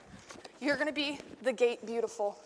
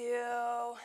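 normal talking voice

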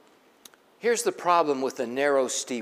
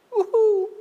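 joyful whoop; 7 dB louder than usual. Acted. Joyful cry in sermon.

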